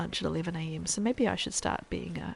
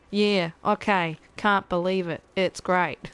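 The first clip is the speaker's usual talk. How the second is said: slow, louder mock Australian accent; Australian text-to-speech voice; 7 dB louder, slower than usual. Streamer mimicking her Australian sub readout voice, slow and loud. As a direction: imitate the Australian sub-alert voice slowly. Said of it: "my sub readout voice Australian".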